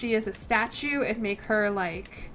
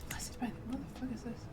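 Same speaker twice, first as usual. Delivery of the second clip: quick whisper; 13 dB quieter, faster than usual. Real, not acted. Reading on-screen text confused.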